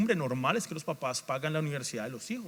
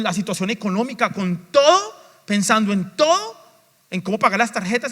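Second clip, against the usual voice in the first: louder, higher, rising list of worldly cares; preaching at full force; 5 semitones higher, 6 dB louder than usual. Acted. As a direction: drive the list home like a preacher. Preaching builds through list. (predicando con fuerza, cada vez más alto)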